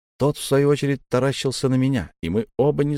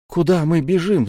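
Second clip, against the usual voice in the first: higher, tight voice; strained question; 6 semitones higher, 3 dB louder than usual. A narrator's reading. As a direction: ask it as if choked with worry. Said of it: "сдавленно спросил я".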